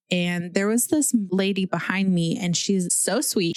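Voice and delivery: normal talking voice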